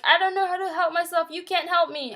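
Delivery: loud, high, fast despairing outburst; mock scream. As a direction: fling it at the therapist in despair. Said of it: "I, like, screamed that at him".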